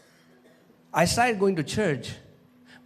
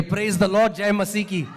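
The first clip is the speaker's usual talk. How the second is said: loud, raised defiant chant; mock congregational shouting; 5 semitones higher, 3 dB louder than usual. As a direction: call it out like a stubborn congregation. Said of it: "हम चिल्ला चिल्ला के बोलेंगे". (चिल्लाकर नारा लगाने की नकल)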